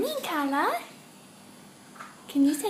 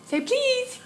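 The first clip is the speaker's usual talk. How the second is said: "please" lifted much higher, coaxing; high sing-song prompt; 11 semitones higher than usual. Shown as a demonstration. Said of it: "can you say the word please".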